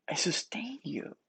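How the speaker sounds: softly whispered promise; a hushed, tender vow. Retold or imitated. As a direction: breathe it like a gentle reassurance. Tender imagined words of God.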